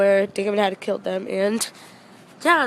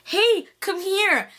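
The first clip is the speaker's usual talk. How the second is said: drawn-out, higher-pitched beckoning call; calling over; 9 semitones higher, 4 dB louder, much slower than usual. Retold or imitated. Actor calling a fan to his table, drawn-out beckoning. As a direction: imitate someone calling a person over, slowly.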